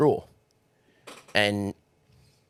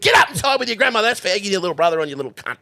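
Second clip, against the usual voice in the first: mock scolding yell; 12 semitones higher, 8 dB louder, faster than usual. Retold scolding from a grandparent.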